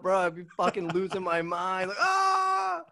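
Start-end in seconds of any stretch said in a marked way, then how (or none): 1.9-2.8 a much higher startled scream of panic; mock scream. Guest pictures Goombas running at him and screams in mock terror. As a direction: yelp like Goombas are charging at you.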